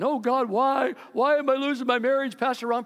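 mock a churchgoer's self-pitying wail; whiny complaint.

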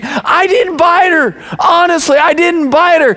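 put-on high-pitched, pleading kid's denial; protesting child. Accused older sister in the car to her parents, mock protest. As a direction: imitate an accused little girl protesting, pitched well up. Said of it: "Kylie is right behind her saying".

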